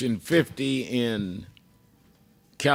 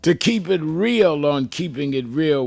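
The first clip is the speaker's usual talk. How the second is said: big, high-pitched radio show opening with long pauses; dramatic announcer intro; 6 semitones higher, 4 dB louder, slower than usual. Acted. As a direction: proclaim it loudly, stretching the key words.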